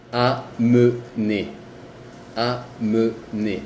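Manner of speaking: amener said slowly, read as written; French amener, slow and clear. Shown as a demonstration. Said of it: "Ahí se lee cómo se escribe".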